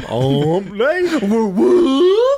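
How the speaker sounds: high, rising woo woo call; hump day cheer. Shown as a demonstration. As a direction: sing out the woo woo bit. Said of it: "getting anybody to say hump day".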